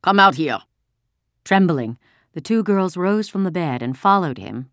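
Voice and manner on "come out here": put-on loud German-accented command; officer snapping an instruction; barked order. A narrator's reading. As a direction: order them out sharply. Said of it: "he ordered".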